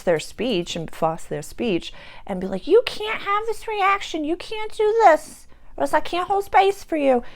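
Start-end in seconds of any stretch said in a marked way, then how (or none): 2.7-7.2 mock bossy scold. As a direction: parody a controlling person laying down rules. Mocking controlling voice.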